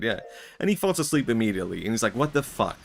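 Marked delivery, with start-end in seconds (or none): 2.2-2.8 a half-hearted, exasperated curse; mock exasperation. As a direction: act out the king's annoyance, lightly. King fails the trial, exasperated.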